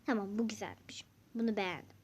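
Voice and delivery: soft private whisper to the camera; whispering a little verdict to herself. Real, not acted. Whispering to viewers about the outfit she chose. Quietly approving chosen item.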